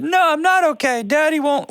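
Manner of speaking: very high mock tantrum voice; screaming kid. Host playing the kid over dad's shoulder, mock tantrum. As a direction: squeal like a kid throwing a fit. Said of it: "the kid's screaming and yelling".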